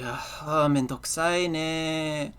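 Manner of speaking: put-on drawn-out Japanese baby-talk whine; mock baby talk. Showing the couple's weird Japanese habit, mild baby talk. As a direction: lightly imitate slow, cutesy baby talk. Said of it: "the baby talk kinda thing".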